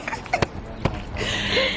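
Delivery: laugh. Real, not acted. Laughing at scam comment.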